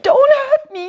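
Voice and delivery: scared lion whimpering to the mouse. Acted. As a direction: beg like a terrified lion. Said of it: "he whimpered".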